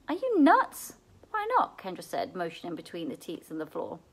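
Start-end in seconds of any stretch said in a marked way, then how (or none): 0.1-1.8 sharp high disbelief; can't believe the plan; incredulous. A narrator's reading. Kendra balks at Seth's milking idea, incredulous.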